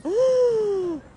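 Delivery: loud, high, long gasp; panicked mock gasp. Acting out horror at the world's chaos, big gasp. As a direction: suck in air in exaggerated horror.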